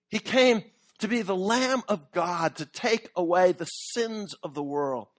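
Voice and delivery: ordinary talk, nothing marked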